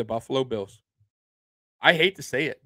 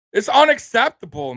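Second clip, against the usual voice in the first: indignant; 7 semitones higher, 6 dB louder than usual. Real, not acted. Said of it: "I'm pissed".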